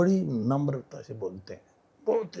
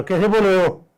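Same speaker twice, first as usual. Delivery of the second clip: louder, higher, sharply offended challenge; sharp reaction; 6 semitones higher, 6 dB louder than usual. Retold or imitated. Visitor confronting policemen at the garden, sharply offended. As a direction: snap back at the policemen's rudeness. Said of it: "मैं एकदम से react किया".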